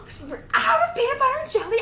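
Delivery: put-on high child voice, rushed; Lilo impression. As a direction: chatter like a little girl. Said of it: "other voice impressions".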